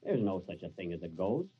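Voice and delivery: normal talking voice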